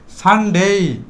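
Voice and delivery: সানডে ending in a held "dei"; long day. Shown as a demonstration. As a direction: hold the last syllable of সানডে. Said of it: "long time উচ্চারণ করব". (সানডে-র শেষটা টেনে "ডেই")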